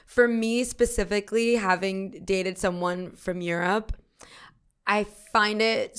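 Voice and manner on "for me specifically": ordinary delivery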